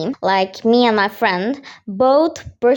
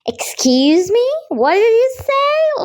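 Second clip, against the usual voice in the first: affronted, higher-pitched challenge; indignant; 10 semitones higher than usual. Retold or imitated. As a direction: act out an offended double-take. Confronting friend mocking her brother.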